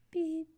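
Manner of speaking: high beep replacing a cuss; mock censor beep. Censoring her own swear word with a high beep. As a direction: chirp it like a bleep.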